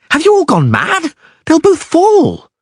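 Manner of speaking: high, loud indignant shout; outraged shout. A narrator's reading. Dandelion railing at the others who won't help, high outraged shout. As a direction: rail at them in disbelief.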